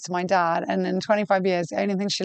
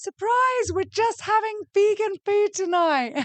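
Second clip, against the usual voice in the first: a much higher, teasing announcement, slowly; mock surprise; 12 semitones higher, slower than usual. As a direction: sing out the surprise high to tease her. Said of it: "I'll be like".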